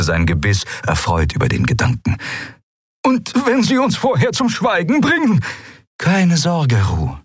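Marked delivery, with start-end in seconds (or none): 3.0-5.4 worried question. Acted. Frightened question from old admiral. 6.0-7.2 higher, calm confident reassurance; calm the frightened old admiral; reassuring. Acted.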